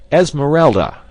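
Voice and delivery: loud, high call of her name; cried out. A narrator's reading. Clayton shakes the maid roughly, loud call. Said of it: "he cried again".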